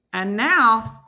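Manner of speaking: usual speaking voice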